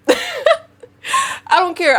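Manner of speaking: high, slow, laughing "I don't care"; laughing dismissal. Real, not acted. Dismissing abuser excuses to viewers, high slow laughing refusal. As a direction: laugh it off and drag each word. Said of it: "laughing emphatic repeat".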